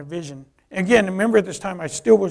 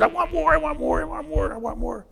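high, breathless-sounding repeated craving; eager begging; 10 semitones higher than usual. Retold or imitated. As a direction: beg greedily in a higher voice. Acting out hunger for God's word.

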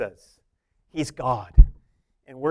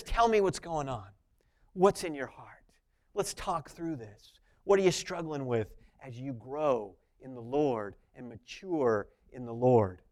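much softer, coaxing father's voice; a caring father asking his child what's wrong; gentle counsel; 5 semitones lower, 9 dB quieter than usual. Retold or imitated. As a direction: invite them to open up, gently.

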